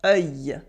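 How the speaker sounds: "Œil" as rounded open "uh" gliding into y, low-pitched; French œil sound. Shown as a demonstration. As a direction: say "Œil" low, rounded vowel plus y-glide. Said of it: "The œil sound is a funny sound".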